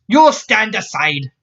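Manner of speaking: slow, high, insistent Scots order; Scots accent command. Acted. Scotswoman standing her ground against Jack, insistent Scots accent. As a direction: order him aside in a Scots accent, slowly.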